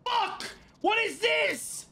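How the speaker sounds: high, slow exclamation of dismay; exasperated cry. Real, not acted. Player dismayed at looted gear, high exasperated cry. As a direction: wail it high in real frustration.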